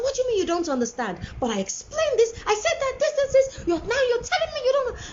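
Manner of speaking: put-on fast, high-pitched scolding of a child; acting out an overwrought parent; exasperated mother. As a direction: pile up the complaints, voice climbing. Acted quarrel, stressed mother to son.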